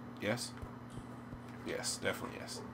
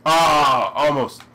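very loud, higher outburst; near-miss shout; 4 semitones higher, 15 dB louder, much slower than usual. Real, not acted.

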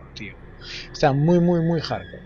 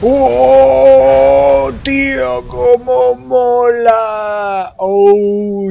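excited shout; 9 semitones higher, 9 dB louder, much slower than usual. Real, not acted. Gamer celebrating a win.

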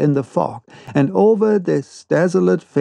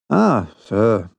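ironical; 4 semitones lower than usual. A narrator's reading. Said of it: "said Captain Nemo in an ironical tone".

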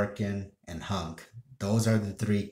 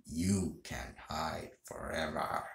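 slow, soft, lowered villain voice; Wesker; 3 semitones lower, 8 dB quieter, slower than usual. Acted. Gamer trying out a game villain's line, soft slow Wesker imitation. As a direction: quietly menace them in a deepened voice. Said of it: "If I lower my voice a little bit deep".

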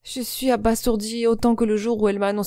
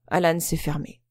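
usual speaking voice

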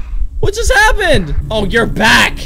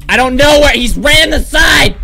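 scared yell, much higher and louder; panicked shout; 8 semitones higher, 6 dB louder than usual. Real, not acted. Panicked player losing track of enemy.

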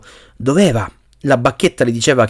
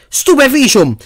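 high-pitched cried incantation; shouted spell; 12 semitones higher, 4 dB louder than usual. A narrator's reading. Harry casts at the giant spider, shouting high. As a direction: cry out the spell. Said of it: "gridò Harry".

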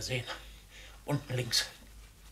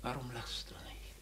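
tense whisper; 3 semitones lower, 12 dB quieter than usual. Acted. Father presses his daughter.

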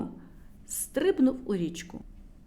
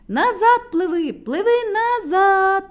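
yelling back; 9 semitones higher, 8 dB louder than usual. A narrator's reading. Others on the bridge yell at Burchun to swim back. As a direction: shout him back. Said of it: "кричали інші".

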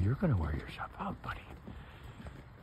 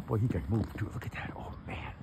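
ordinary talk

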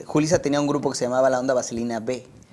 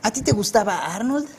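higher cartoon boy voice; Hey Arnold; 6 semitones higher than usual. Shown as a demonstration. Dubber asking the host in his cartoon role, higher boy voice. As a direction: ask it in the pitched-up dubbed kid voice. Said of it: "la voz de Hey Arnold".

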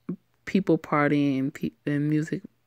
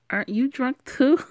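teasing; 9 semitones higher, 4 dB louder than usual. Acted. Drunk girl teasing Alex.

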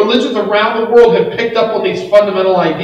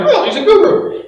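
higher-pitched, lightly acted "oh, of course"; mock realization; 12 semitones higher than usual. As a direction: imitate someone pleasantly catching on.

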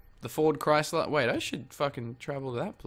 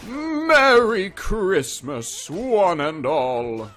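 higher, slow, drawn-out Nicholson greeting; Jack Nicholson impression; 5 semitones higher, 6 dB louder, much slower than usual. Gamer repeats his Jack Nicholson bit, slow and high. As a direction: stretch each word like Nicholson playing Santa. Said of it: "That's my impression of Jack".

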